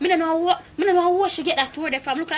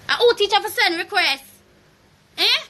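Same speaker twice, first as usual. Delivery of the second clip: louder, higher angry repeat; 6 semitones higher, 4 dB louder than usual. Real, not acted. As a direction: throw it back at her louder. Repeats the line, second louder.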